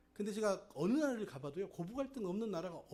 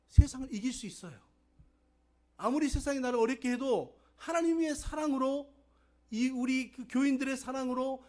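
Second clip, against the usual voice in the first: high, loud, rousing sermon voice; forceful preaching; 6 semitones higher, 10 dB louder than usual. Real, not acted. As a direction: proclaim it with rising conviction. Preaches encouragement at full force. (확신에 차서 높이 외치는 설교)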